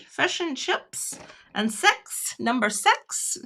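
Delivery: slow, careful New Zealand vowels; New Zealand accent. Shown as a demonstration. Checking the imitation for viewers, in a put-on New Zealand accent. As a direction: do New Zealand short vowels, six sounding like "sex". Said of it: "More New Zealander".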